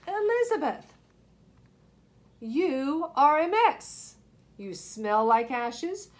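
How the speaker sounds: lightly voiced, sniffy disapproval; snooty prince. Prince Ronald scolding Elizabeth for her looks, lightly snooty.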